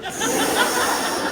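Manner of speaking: squealing hammed-up laugh; high cackling laugh. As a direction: cackle at his misfortune. Sacagawea laughs in the story.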